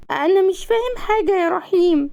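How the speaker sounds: put-on high-pitched child's voice; small, high, childlike voice; puzzled little child. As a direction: whine it like a confused small kid.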